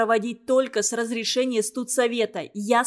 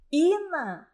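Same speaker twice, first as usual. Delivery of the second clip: higher, drawn-out drowsy call; sleepy voice; 6 semitones higher than usual. A narrator's reading. Светлана answering Инна from behind the door, sleepy voice. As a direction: call out as if just woken. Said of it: "послышался заспанный голос Светланы".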